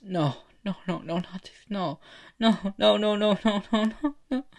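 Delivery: high, mounting panic; panicked denial. Acted. Boy horrified by the vision.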